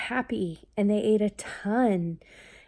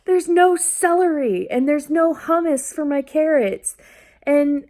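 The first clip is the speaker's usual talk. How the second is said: put-on high, louder child voice listing demands; demanding kid; 8 semitones higher, 8 dB louder than usual. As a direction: imitate the son insisting.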